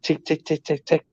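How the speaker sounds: light, quick ticking of claws; soft little tick-tick-tick; imitated dog footsteps. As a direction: tick softly like paws on laminate. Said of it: "the sounds of the friggin' footsteps".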